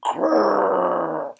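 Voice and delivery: long rolled "grrr"; tiger growl. A narrator's reading. Tigers holding each other's tails answer, growling. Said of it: "they could only say".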